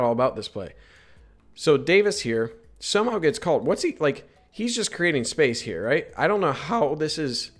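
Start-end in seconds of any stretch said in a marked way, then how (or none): 6.2-7.5 real crack in a raised voice. Real, not acted. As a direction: voice breaks upward mid-sentence. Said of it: "voice crack".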